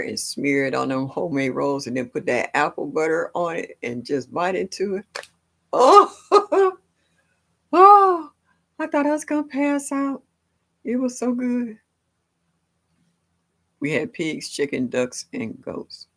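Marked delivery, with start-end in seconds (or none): 5.7-11.8 high, loud swooning over the taste; swooning food rapture; ecstatic delight. Real, not acted. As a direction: gush like it's the best bite ever. Savoring grandma's rolls.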